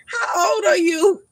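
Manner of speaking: high, slow, drawn-out disbelieving question; incredulous how old. Retold or imitated. Asking a young man hitting on her, in high drawn-out disbelief. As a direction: stretch it out in mock shock at his age. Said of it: "And I be like".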